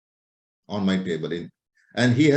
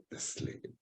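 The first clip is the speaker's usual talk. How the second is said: sheepish whisper, no voice at all; 20 dB quieter than usual. Retold or imitated. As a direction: breathe it like a guilty secret. Patient confesses about third phone.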